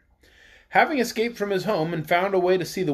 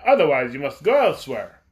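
lower, louder innkeeper voice; a curt innkeeper setting terms; gruff innkeeper; 4 semitones lower, 4 dB louder than usual. A narrator's reading. Innkeeper turns Kiki away.

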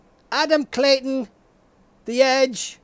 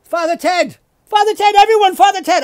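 higher, louder game-show announcing; excited host shout; 7 semitones higher, 7 dB louder, faster than usual. Pretend. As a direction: cheer the answer to the crowd. Game-show host hyping an answer.

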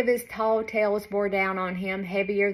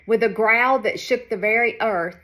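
ordinary delivery

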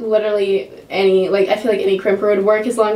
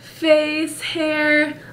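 conceited; 6 semitones higher, 3 dB louder, much slower than usual. Pretend. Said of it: "I feel conceited".

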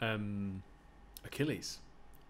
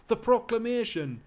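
angry Geordie bruiser, high and loud; big strong Geordie losing his temper; Geordie accent; 12 semitones higher, 8 dB louder than usual. Acted. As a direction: bellow insults in Geordie. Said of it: "give him a Geordie accent".

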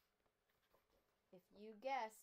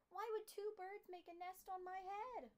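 alarmed; 9 semitones higher, 6 dB louder than usual. Acted. Gerald alarmed by the nest.

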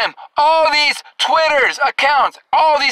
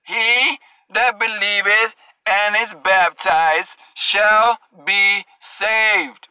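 lower, very slow, every word weighed; dragging out shall be saved, lower and deliberate; slow emphatic reading; 3 semitones lower, much slower than usual. Shown as a demonstration. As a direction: spell the verse out slowly for the slow learners.

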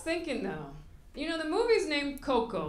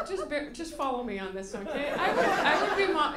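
ordinary talk

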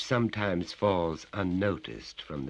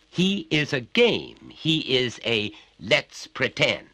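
slow, weighty father voice; stern father; 8 semitones higher, 7 dB louder, slower than usual. Acted. Father drills the boy, slow and stern. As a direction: lay each word down slowly.

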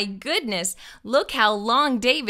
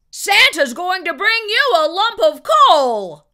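loud, higher angry scold; angry shout; 8 semitones higher, 9 dB louder than usual. Acted. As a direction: shout the threat at him. Angry parent threat.

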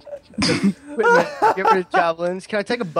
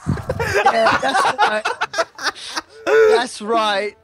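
stifled giggles; 4 semitones higher, 4 dB louder, much slower than usual. Real, not acted. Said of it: "I'm trying not to laugh too hard".

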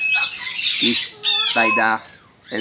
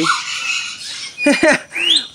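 breathy burst of relieved laughter; relieved high laugh. Real, not acted. Relief after egg comes out.

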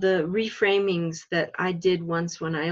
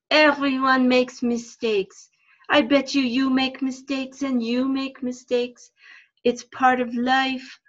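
put-on a patient fox puppet, higher and unhurried, French accent; 6 semitones higher than usual. The puppet fox comforts children about mistakes, higher French accent. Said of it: "I speak in the French accent".

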